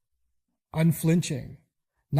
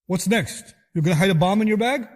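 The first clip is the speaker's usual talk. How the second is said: louder and higher, a panicked father; a father's raised, accusing outburst; alarmed father; 4 semitones higher, 5 dB louder than usual. Retold or imitated. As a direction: rebuke the child in alarm. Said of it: "the father says".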